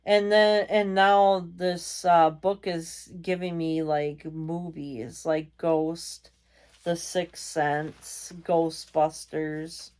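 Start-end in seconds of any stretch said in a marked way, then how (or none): none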